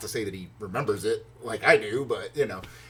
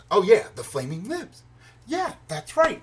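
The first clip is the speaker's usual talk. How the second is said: higher, pleased "oh yeah" moment; sudden realization; 8 semitones higher, 3 dB louder than usual. Retold or imitated. Fan switches from philosophy to favorite band, sudden realization. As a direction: act out remembering something good.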